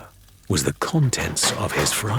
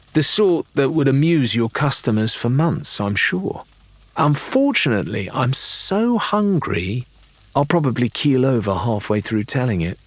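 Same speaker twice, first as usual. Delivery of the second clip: higher, theatrical plea of starving; coaxing Lorena; 5 semitones higher than usual. Acted. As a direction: sell the story, then milk the hunger. Lorena bargains, pleads hunger.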